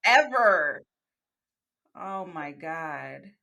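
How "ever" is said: emphatic ever. Real, not acted. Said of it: "Don't fucking pat my head".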